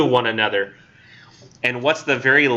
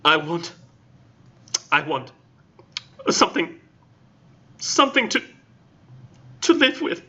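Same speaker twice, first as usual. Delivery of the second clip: heartbroken; 2 semitones higher than usual. A narrator's reading. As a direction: beg for something to hold on to. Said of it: "in a heartbroken tone".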